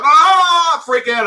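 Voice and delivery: high yelping cry of someone burnt by chili; mock yell of pain. Friends who ate his hottest chili, loud high mock yell. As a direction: yelp it out loud, hammed-up.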